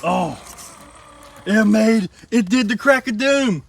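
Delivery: loud, high, thrilled outburst; excited shout. Real, not acted. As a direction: shout it out in amazement. Truck clears hard obstacle.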